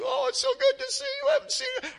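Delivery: very high, fast, sing-song greeter; sugary welcome. Pretend. Preacher mimics a gushing greeter at the airport, very high. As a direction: gush like an overeager host. Mock friendly greeting after trip.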